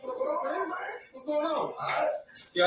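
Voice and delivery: ordinary talk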